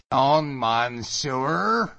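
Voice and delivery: loud, high, drawn-out mocking echo; mocking mimicry. Allan mimics the sailor's French, loudly mocking. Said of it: "mocking him".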